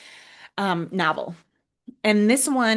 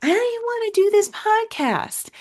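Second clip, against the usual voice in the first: much higher, whiny reluctant voice; reluctant whine; 11 semitones higher than usual. Retold or imitated. Host mimicking her own reluctance about recording, whiny. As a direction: pout it out like you really don't want to. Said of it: "Every part of me today even wanted to be like".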